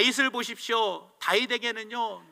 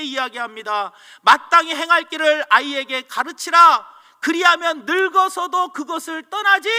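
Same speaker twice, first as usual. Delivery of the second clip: loud, high, impassioned preaching; fiery preaching; 6 semitones higher, 7 dB louder than usual. Acted. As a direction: drive the message home to the congregation. Preaching at full force. (온 힘을 다해 외치는 설교)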